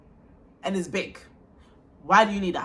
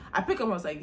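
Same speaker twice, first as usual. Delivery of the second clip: ordinary delivery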